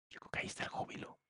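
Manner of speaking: a hushed, quick aside; whispered realization. Acted. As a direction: breathe it out as a whispered insight. Soft conclusion after a pause.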